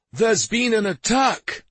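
high, loud and slow alarm; shouting. Acted. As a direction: shout the warning across the fort. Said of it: "the warrior shouted".

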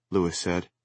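usual speaking voice

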